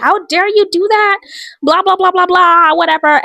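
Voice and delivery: hammed-up indignant "How dare you"; mock scolding. Male lead scolding the heroine.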